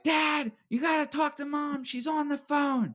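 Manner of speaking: put-on a twelve-year-old begging his dad to listen; little brother's voice. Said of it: "I hear this little shithead's voice".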